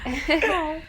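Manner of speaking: loud, laughing delight; a laughing, affectionate aw. Real, not acted. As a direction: laugh through it fondly. Laughing reply to friend.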